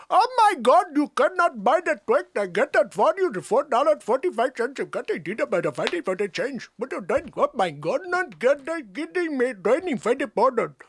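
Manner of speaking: high-pitched, exaggerated Indian accent; hammed-up Indian shopkeeper accent; mock Indian accent. New York shopkeeper to a Twix buyer, exaggerated Indian accent. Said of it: "They asked for an Indian accent".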